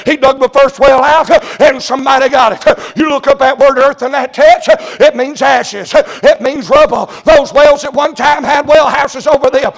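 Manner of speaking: driving revival preacher cadence; full-force preaching. Real, not acted. Preacher asking congregation how thirsty they are for God, full-force preaching. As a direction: press each question hard on the listeners.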